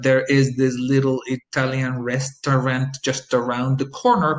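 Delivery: slow, word by word, restaurant in three syllables; slow and robotic. Shown as a demonstration. Teacher mocks stiff speech, slow and robotic. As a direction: spell it out mechanically. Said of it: "which is very slow and robotic".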